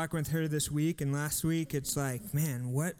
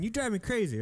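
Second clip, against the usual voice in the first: lightly voiced scolding mom, a bit higher; a hint of a mom; 5 semitones higher, faster than usual. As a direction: toss it off like a fed-up mom. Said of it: "That could have been a mom saying".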